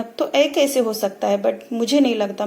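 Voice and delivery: ordinary delivery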